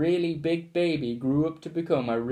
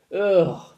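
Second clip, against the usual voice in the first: loud disgusted ugh groan; bored school groan; 3 semitones higher, 7 dB louder than usual. A narrator's reading. School groans at the family, loud ugh. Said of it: "the whole school groaned".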